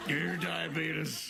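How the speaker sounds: slow, drawn-out Brimley catchphrase; laughing slow mock Brimley; mock Brimley line. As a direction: stretch it out like the old ad. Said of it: "Brimley catchphrase bit".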